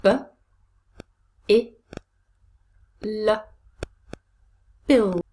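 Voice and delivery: b, i, l said apart, then blended as bill; short separate b, i, l, then a firm bill; sounding out. Shown as a demonstration. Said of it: "Fourth syllable".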